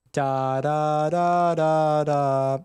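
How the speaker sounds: smooth, connected sung syllables on "da"; legato. Shown as a demonstration. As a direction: let each "da" flow into the next on a held tone. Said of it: "anyways, that was legato".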